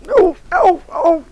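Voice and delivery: high, loud ow-ow yelps; real pained oh oh; pained cries. Real, not acted. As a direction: yelp as the cat walks on you. Cat walks across her.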